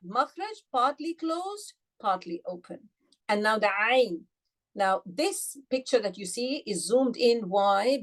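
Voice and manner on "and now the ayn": usual speaking voice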